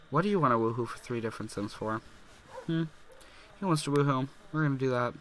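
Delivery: normal talking voice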